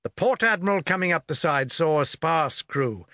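ordinary delivery, nothing marked

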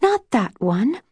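impatient whisper. A narrator's reading. Snow rejecting her sister's story choice, mildly impatient. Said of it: "Snow whispered impatiently".

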